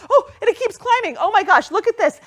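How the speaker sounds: high and loud with delight; real thrill, voice shooting up; excited. Real, not acted. Host watching the donations climb, excited. Total climbing live on stream.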